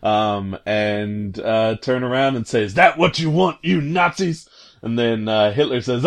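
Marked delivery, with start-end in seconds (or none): 2.6-4.5 defiant shout. Retold or imitated. Hero shouting at the Nazis.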